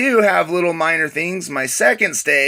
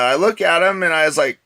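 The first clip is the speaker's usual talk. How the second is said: normal talking voice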